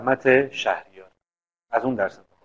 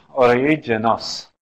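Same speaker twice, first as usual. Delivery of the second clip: ordinary delivery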